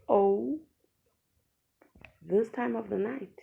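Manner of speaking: exasperated outcry; loud protest. Retold or imitated. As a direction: complain loudly in disbelief. Protesting being sent home late.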